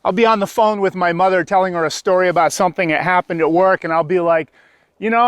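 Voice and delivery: ordinary talk, nothing marked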